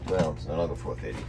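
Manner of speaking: ordinary delivery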